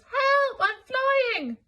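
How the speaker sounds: high, loud panicked shout; panicked cry. Acted. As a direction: yell as if blown away. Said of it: "came Elmer's voice".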